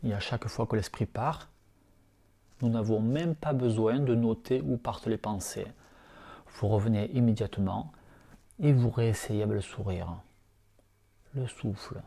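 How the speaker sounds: soft, hushed meditation voice, unhurried; guided meditation. Acted. Teacher guiding lying students through yoga nidra, soft meditation voice. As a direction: ease listeners into calm breathing awareness.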